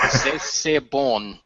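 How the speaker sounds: very slow mock French; fake French. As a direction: savour it in broken French. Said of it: "pidgin shit French".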